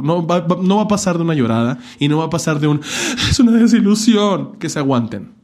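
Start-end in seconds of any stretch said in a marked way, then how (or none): 3.3-4.6 high, loud theatrical lament; mock parent drama. Mocking parents' disappointment, high and loud theatrical. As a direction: ham up a disappointed mother.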